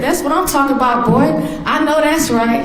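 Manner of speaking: high, fast, delighted cheer; proud praise. Retold or imitated. Mother praising her son for giving blood, high and fast. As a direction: hype him up like a proud mom.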